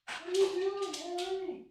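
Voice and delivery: very high sing-song voice for the dog, called from across the room; high-pitched pet talk to the dog away from the mic. Real, not acted. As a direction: coo at a naughty puppy, pitch way up. Streamer talking to his dog.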